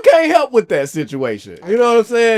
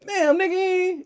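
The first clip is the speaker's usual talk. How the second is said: exasperated; 11 semitones higher, 7 dB quieter, slower than usual. Retold or imitated. Imagined reaction in hospital.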